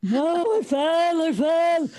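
strained protest. Retold or imitated. Protesting to police while winded.

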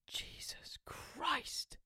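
high, slow whispered exclamation; excited whisper. Acted. George thrilled by the phone call, high whisper. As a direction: gasp it under your breath. I thought.

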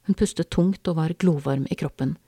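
ordinary delivery, nothing marked

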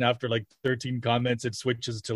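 normal talking voice

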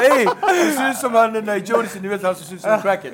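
high, loud, laughing retold remark; laughing while quoting himself; laughing quote. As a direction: say it while cracking up. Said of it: "Jag bara".

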